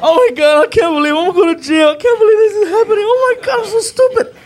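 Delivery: high, loud, panicked wail; freaking out. Acted. Driver facing jail, hysterical high-pitched panic. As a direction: wail it on the verge of tears. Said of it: "freaking out".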